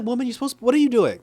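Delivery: high-pitched, incredulous telling-off; mock exasperated outburst; exasperated scolding. Scolding the crying warrior in exasperation.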